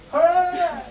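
high loud cheer of "Hey". Shown as a demonstration. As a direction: shout "Hey" like a happy crowd. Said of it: "So if you could sort of go".

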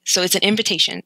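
ordinary delivery

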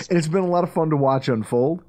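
ordinary delivery

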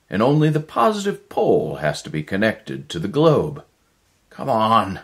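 desperate inventor rallying his partner; urgent scientist. Acted. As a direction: push him to hurry, breathless with urgency. Erickson urges Miller on.

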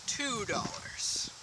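slow whispered aside. Acted. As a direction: whisper the counteroffer slowly. Haggling aside.

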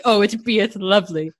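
lightly put-on old-timey gentry voice; a hint of prim period-drama speech; mock Mister Darcy talk. Friends playfully bantering in a mock Mister Darcy voice. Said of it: "in Mister Darcy talk".

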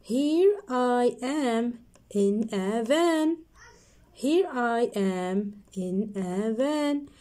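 slow, drawn-out line; slow reading. Shown as a demonstration. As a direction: stretch it for kids. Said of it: "Let's read it together".

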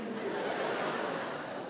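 soft airless chuckle; breathy laugh. Real, not acted. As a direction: laugh under your breath at the fall. Friend falls in story. (risadinha soprada)

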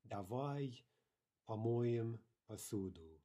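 slow "Давай", pause, then drawn-out "помоем посуду"; word by word. Shown as a demonstration. Teacher modelling a Russian sentence for learners, slow word by word.

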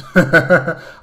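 short loud incredulous laugh; disbelieving laugh. Real, not acted. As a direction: burst out laughing at the idea.

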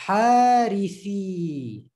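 Harithi slowly, each sound long; slow drawn-out Harithi. Shown as a demonstration. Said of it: "take the letter of mad".

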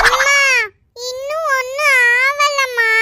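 put-on slow, drawn-out childish whimper; tearful whine. Fallen child whines to mother.

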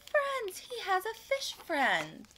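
high, slow, wondering announcement; drawn-out, high-pitched storytime excitement; amazed delight. Pretend. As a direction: share a thrilling discovery with little listeners. Reader reacts to story's happy twist.

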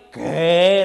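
un qué fuerte y atónito; no se lo puede creer; sorpresa. Retold or imitated. Vecina sorprendida. (la hermanita sorprendida, grita qué)

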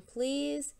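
begging. Acted. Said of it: "the mouse begged".

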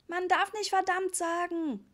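put-on high, slow, prim child scolding; scolding little boy. A narrator's reading. Little Richie scolds Papa for swearing. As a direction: tell Papa off like a know-it-all kid. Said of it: "schimpfte Richie".

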